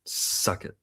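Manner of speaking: whispered pun. Pretend. Joking back at co-host, whispered Suck it.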